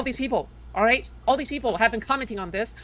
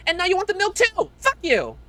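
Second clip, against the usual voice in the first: higher, louder burst of anger; angry outburst; 8 semitones higher, 4 dB louder than usual. Real, not acted. Streamer lashing out at critics who want more, loud angry outburst. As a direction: snap back at the ungrateful critics.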